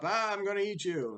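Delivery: high, fast pouncing troll threat; menacing troll. Acted. Troll leaping up at another goat, hammed-up threat. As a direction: jump out with a mock threat.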